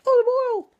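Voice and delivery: very high, loud squeaky cartoon lady; Olive Oyl squeak. Popeye bit, Olive Oyl.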